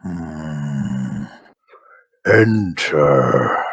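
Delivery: low, slow shaman intoning; deep ritual orc priest. Acted.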